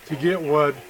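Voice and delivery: normal talking voice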